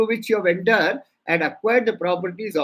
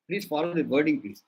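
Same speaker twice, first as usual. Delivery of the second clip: ordinary talk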